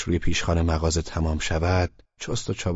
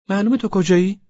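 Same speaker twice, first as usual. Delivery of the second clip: put-on high, loud girlfriend voice; pitched up as the annoyed girl; girl's voice; 12 semitones higher, 6 dB louder than usual. A narrator's reading. Laleh scolding him for being late, high voice.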